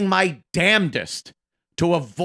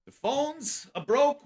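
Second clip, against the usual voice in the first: higher, softer and slow, rising at the end; mock whiny Opie; 8 semitones higher, 6 dB quieter than usual. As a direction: imitate him dragging out the complaint, a little higher. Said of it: "when he used to come in".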